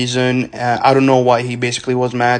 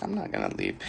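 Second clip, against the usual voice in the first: very soft, low refusal; respectful, not shouting; 18 dB quieter, faster than usual. Retold or imitated. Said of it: "I didn't shout or anything".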